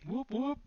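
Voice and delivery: high whooping siren; siren imitation.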